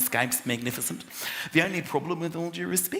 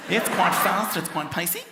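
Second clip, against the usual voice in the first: quick, rising New Zealand imitation; New Zealand accent; 3 semitones higher than usual. Shown as a demonstration. Comic showing how New Zealanders talk, pacey New Zealand accent. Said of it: "they talk like that in New Zealand".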